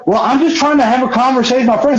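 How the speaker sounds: high, fast defensive whine; whiny excuse. Retold or imitated. Billy explains himself to his friend, whining. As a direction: plead your case, put upon.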